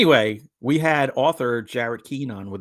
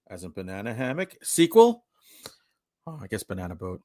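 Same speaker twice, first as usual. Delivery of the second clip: ordinary talk, nothing marked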